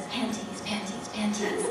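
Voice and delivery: unvoiced whispered chant; whispered swearing. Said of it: "he says".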